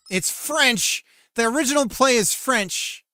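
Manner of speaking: dismayed outburst. Real, not acted. Dismayed discovery, louder.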